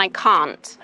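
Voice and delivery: "can't" with a long "ah"; British pronunciation. Shown as a demonstration. Teacher setting can't against short can, British pronunciation. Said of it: "In British English".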